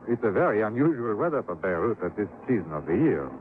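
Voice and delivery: put-on polite French-accented official; smooth, courteous French accent. Port commissioner welcoming the investigator, courteous French accent.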